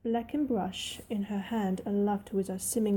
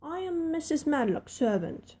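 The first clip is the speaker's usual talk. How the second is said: stoutly; 6 semitones higher than usual. Acted. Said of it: "she said stoutly".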